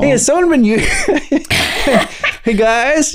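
high-pitched amused mock call-out; laughing mock scenario. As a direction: act it out, cracking up. Acts out roommates, breaking into laughter.

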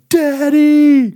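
put-on high, loud wail of a sobbing child; a high, loud, tearful child's cry; crying call. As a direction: cry out for daddy like a scared little girl. Sobbing daughter calls out.

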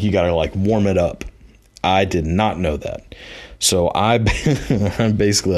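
usual speaking voice

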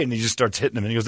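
usual speaking voice

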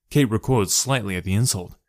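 ordinary delivery, nothing marked

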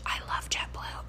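soft, whispered confession; hushed, voiceless aside. Real, not acted. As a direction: drop to a whisper, as if confiding. Whispered aside about favourite airline.

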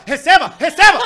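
loud, rising Receba shouts with laughter; repeated Receba shouts. Retold or imitated. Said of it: "Tentem imaginar".

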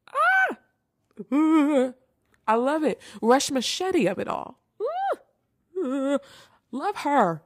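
high squealing ooh and ah of delight; excited squeals. Real, not acted. Gushing over favourite actress.

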